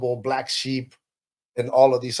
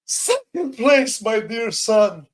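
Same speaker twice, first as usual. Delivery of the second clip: grandmother's reply; 10 semitones higher, 3 dB louder, much slower than usual. Retold or imitated. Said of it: "And she says".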